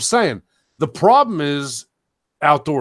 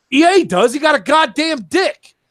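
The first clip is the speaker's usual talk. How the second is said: higher, louder angry retort; angry outburst; 9 semitones higher, 4 dB louder than usual. Real, not acted. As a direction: snap back in heated disbelief. Heated rant about gender.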